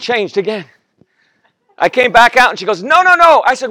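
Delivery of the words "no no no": wife's voice. Retold or imitated. Wife voiced in marriage story.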